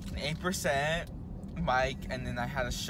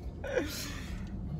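high squeal laugh; 5 dB quieter than usual. Real, not acted. Drunk giggling at the camera.

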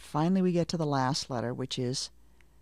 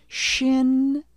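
Shin called out louder and higher; emphatic Shin; 9 semitones higher, 6 dB louder than usual. Shown as a demonstration. Teacher calling out Shin for learners. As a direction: call out Shin for the echo. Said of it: "Repeat the last letter after me".

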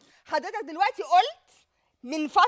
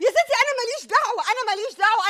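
higher, louder pleading denial; loud exasperated plea, pitch raised; exasperated protest; 7 semitones higher, 5 dB louder than usual. Acted. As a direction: beg them to leave you out.

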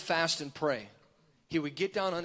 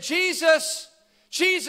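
high, mildly raised call after someone; 10 semitones higher, 7 dB louder than usual. Retold or imitated. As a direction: call out as if chasing him. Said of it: "he shouted out to Jesus".

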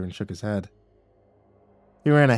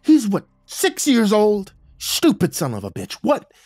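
loud, high furious yell; seeing red; 10 semitones higher, 8 dB louder than usual. Acted. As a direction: get right in his face, raging. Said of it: "I just saw red".

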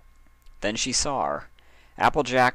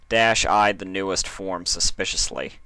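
ordinary delivery, nothing marked

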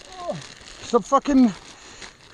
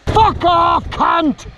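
angry curse; 8 semitones higher, 4 dB louder, much slower than usual. Real, not acted. Frustrated cursing on rocks.

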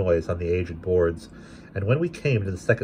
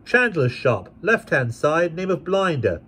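put-on hideous boy; 10 semitones higher, slower than usual. A narrator's reading. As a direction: snap it like a rude lad. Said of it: "said the boy".

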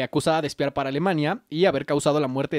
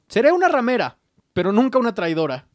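louder, higher defiant declaration; defiant Mata Hari; 5 semitones higher, 5 dB louder than usual. Retold or imitated. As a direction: proclaim it proudly to the court. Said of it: "Mata Hari diría durante su juicio".

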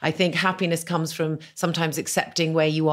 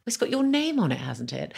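flustered naive guest; 9 semitones higher than usual. Retold or imitated. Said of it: "And I said".

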